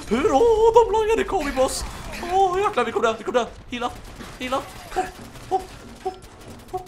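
high, loud panicked yelling at the boss; panicked shout. Real, not acted. Gamer yelling in panic as the boss shows up. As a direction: yell in real panic as the boss appears.

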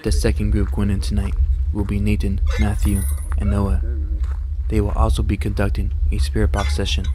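low, even narrator read; documentary voice-over. Acted. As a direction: narrate the ghost hunt calmly. Voice-over introducing the ghost hunt.

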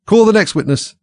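high, loud royal command; king voice. Acted. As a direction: call it out like a pompous king.

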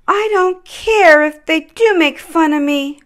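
a small reindeer gathering courage; young Rudolph. A narrator's reading. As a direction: Rudolph deciding to help. Rudolph's voice in story.

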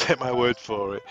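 ordinary talk

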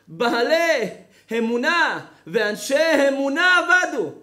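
high, ringing preacher's voice; fervent sermon; preaching. Acted. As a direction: proclaim it to the congregation. Preaching with higher pitch.

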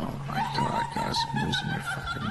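squeaky laughter through the words; high-pitched laughing disbelief. Real, not acted. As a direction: giggle helplessly while talking. Cracking up at the game.

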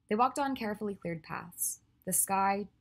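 ordinary delivery

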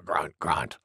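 low, soft boar grunts; trailing piggy grunts; grunting wild boar. A narrator's reading. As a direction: grunt deep after a big sneeze. Wild boar grunts after sneezing.

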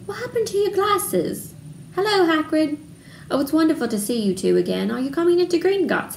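put-on high-pitched, eager girl's voice; excited greeting. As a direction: gush happily on meeting friends. Hermione greets her friends excitedly.

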